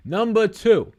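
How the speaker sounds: high, loud announcer voice; hyped-up announcer. Acted. Hypes up a chat answer.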